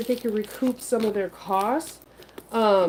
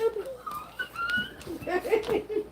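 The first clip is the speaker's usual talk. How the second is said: high squeal laugh; 6 dB quieter than usual. Real, not acted. Laughing at own dog voice.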